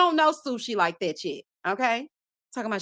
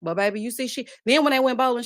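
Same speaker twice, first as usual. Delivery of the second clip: ordinary talk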